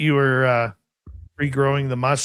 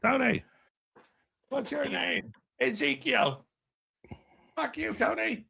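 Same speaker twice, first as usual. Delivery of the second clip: skit voice; 11 semitones higher, 8 dB quieter than usual. Acted. Skit partner, high soft voice.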